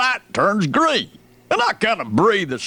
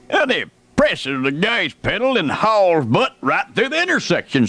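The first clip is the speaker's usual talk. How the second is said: ordinary talk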